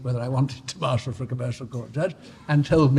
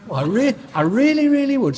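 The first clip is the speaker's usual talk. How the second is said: put-on high, louder general Northern accent, eager; 11 semitones higher, 7 dB louder than usual. Bridge keeper eager to open the bridge, Northern accent. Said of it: "Sorry for my accent, it's general Northern".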